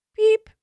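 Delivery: very high, loud squeaky piep; toy squeak. Acted. The girl squeezes a rubber toy, high loud squeak.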